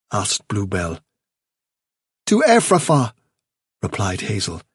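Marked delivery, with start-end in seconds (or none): 2.3-3.1 raised, steady leader voice; young leader addressing the warren; Hazel's calm resolve. Acted.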